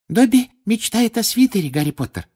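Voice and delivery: high, happy elf announcement; bright squeak dropping on the name; joyful Dobby. A narrator's reading. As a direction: announce your wish gleefully as a little elf. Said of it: "радостно возвестил он".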